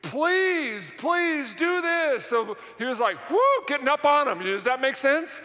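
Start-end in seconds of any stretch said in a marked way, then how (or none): 0.0-2.2 higher, drawn-out plea; begging. Acted. Preacher acts out Peter exhorting the church, begging. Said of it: "the word beg is parakaleo". 3.3-4.4 higher, louder, quick whoop; mock attention-grabbing. Preacher imitates Peter getting the church's attention, mock urgency. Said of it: "wanting to really get their attention".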